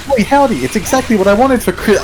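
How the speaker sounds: high, loud burst of retold delight; mock delight. Player acting out short-lived joy at a game drop, excited. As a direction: crow over a lucky find, then get cut short. Retelling joy before noticing the catch.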